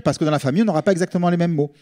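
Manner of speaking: usual speaking voice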